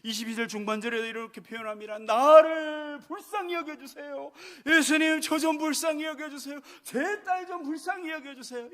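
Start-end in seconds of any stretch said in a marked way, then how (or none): 2.0-8.7 loud, slow pleading cry. Acted. The mother begs Jesus to pity her daughter, crying out. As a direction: beg at full voice, pressing each plea. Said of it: "이렇게 막 부르짖는 거예요".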